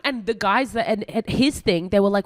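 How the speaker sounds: normal talking voice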